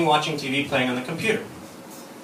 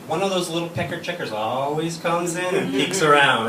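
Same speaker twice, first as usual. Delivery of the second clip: normal talking voice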